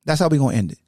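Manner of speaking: ordinary delivery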